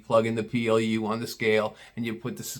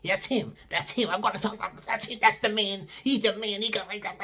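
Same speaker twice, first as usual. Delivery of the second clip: squawking customer; 6 semitones higher, 7 dB quieter than usual. Retold or imitated. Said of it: "I can see her squawking".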